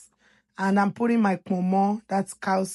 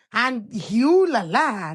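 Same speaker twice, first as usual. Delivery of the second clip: playful reveal; 4 semitones higher, 6 dB louder, slower than usual. Real, not acted. Presents secret ingredient.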